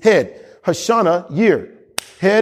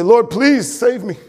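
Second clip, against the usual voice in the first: slow, higher, whiny begging; mock the desperate beggar at prayer; drawn-out pleading prayer, gently mocked; begging plea; 5 semitones higher, slower than usual. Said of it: "Don't come to him and say".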